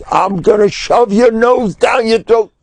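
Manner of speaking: high, strained threat; mock angry threat. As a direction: hurl it like a sailor squaring up for a fight. Retold sailor threatens with his fist.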